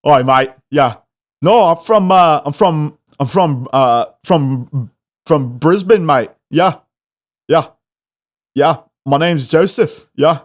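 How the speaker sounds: imitating a chatty Aussie bloke; Australian accent. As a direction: put on a broad, halting Aussie accent. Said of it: "This giant Aussie dude, he's like".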